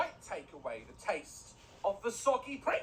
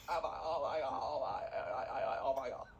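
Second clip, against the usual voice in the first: Valley girl impression; 7 semitones lower, 4 dB quieter than usual. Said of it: "how Valley girls talk".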